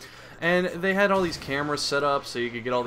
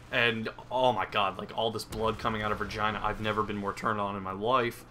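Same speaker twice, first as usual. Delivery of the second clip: ordinary talk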